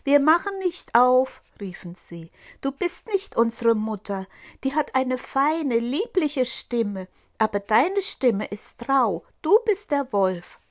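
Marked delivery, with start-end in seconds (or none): 0.0-1.4 higher, louder defiant refusal; kids shouting. Acted. Goat kids refuse the wolf, loud and high. 2.6-10.6 put-on lightly higher kids' voice; defiant little kids; goat kids. Goat kids tell the wolf he is not mother, defiant.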